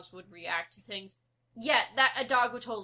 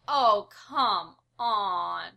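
slow, drawn-out, louder eye-roll; exasperated "come on"; 8 dB louder, much slower than usual. Retold or imitated. Watching the cats fake their bites, drawn-out disbelief. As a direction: drag it out, totally unimpressed. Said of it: "I'm like".

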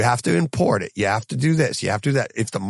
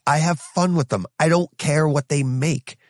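ordinary talk

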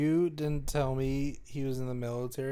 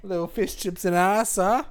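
louder, high British jab; hammed-up Brit; mock British accent; 6 semitones higher, 8 dB louder than usual. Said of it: "British bit".

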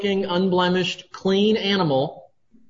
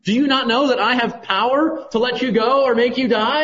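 a raised, lightly voiced demanding Pilate; imitating Pilate; 6 semitones higher, 4 dB louder than usual. Preacher retelling Pilate questioning Jesus, mock demanding. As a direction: challenge the prisoner, voice pitched up and pressing. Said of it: "Pilate at one point says".

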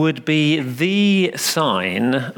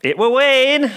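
put-on much higher, louder insistent child; boy crying out undeterred; 12 semitones higher, 5 dB louder than usual. A narrator's reading. Little boy insisting to the vicar, undeterred. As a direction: insist like a stubborn little lad. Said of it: "persisted the child".

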